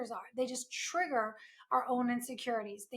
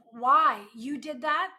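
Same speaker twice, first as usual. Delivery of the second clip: loud, slow, accusing why; mock accusatory partner, drawn out and loud; accusing demand; 8 dB louder, slower than usual. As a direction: fire it at them like a blame. Acted accusing quote, blaming partner.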